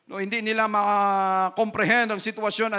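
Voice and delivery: ordinary delivery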